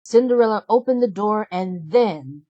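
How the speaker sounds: ordinary delivery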